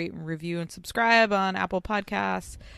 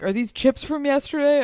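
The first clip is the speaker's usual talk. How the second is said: high, loud mimicked question; Nene; 10 semitones higher, 7 dB louder than usual. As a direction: imitate someone incredulously asking, pitched way up. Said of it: "Nene's like".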